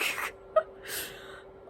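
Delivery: a breathy laugh that wobbles toward tears; shaky stunned laugh. Real, not acted. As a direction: laugh in disbelief, close to crying. Reacting to book twist.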